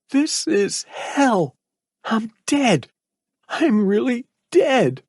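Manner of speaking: put-on high, slow boyish voice, hushed and hopeless; a broken teen whispering to himself; whispered despair. A narrator's reading. Boy in a hellish cell whispers his despair. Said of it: "he whispered".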